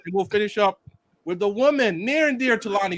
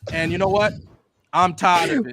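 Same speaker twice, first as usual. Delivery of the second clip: solemn preacher addressing the congregation; hammed-up funeral sermon voice; eulogy for a fan let down by Tokyo Ghoul; mock preacher eulogy; 3 dB louder than usual.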